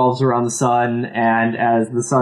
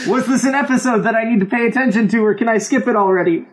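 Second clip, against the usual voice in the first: laughing mock panic; 7 semitones higher, faster than usual. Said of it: "You're gonna say".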